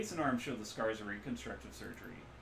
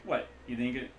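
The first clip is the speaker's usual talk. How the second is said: put-on Midwestern accent; 2 semitones higher, 5 dB louder than usual. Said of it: "loose Midwestern accent".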